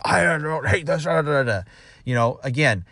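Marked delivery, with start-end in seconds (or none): 0.0-1.6 mock stubborn grumbling. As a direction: imitate a stubborn employee's babbled objections. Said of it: "those really stubborn ones that are just like".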